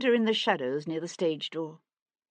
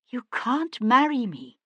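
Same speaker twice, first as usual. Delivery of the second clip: distressed protest; 7 semitones higher, 5 dB louder than usual. A narrator's reading. Cat protests marriage.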